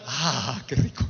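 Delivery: low, sighing contentment; acted relief. Priest finally in the bathroom, mock blissful relief. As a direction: let out a long, low, relieved ah.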